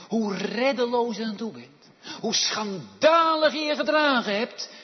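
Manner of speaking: loud, high, fast pulpit cry; full-force preaching. Acted. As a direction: drive the point home to the congregation.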